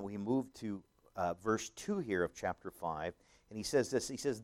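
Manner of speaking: ordinary talk, nothing marked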